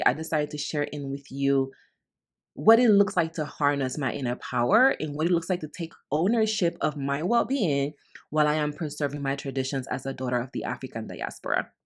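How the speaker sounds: ordinary talk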